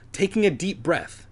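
usual speaking voice

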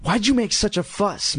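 usual speaking voice

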